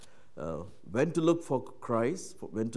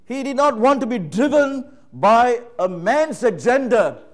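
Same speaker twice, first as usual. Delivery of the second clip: higher, louder emphatic sermon climax; full-force preaching; 10 semitones higher, 13 dB louder than usual. Acted. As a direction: drive the point home from the pulpit.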